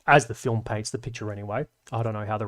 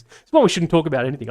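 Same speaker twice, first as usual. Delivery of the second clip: fast, louder and higher joking outburst; self-mocking; 4 semitones higher, 6 dB louder, faster than usual. Real, not acted. Telling viewers he is rambling, fast and louder. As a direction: rattle it off laughing at yourself.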